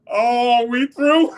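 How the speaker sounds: high, loud laughing cry of alarm; a teammate yelping in mock panic; teammate's alarm. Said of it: "He said".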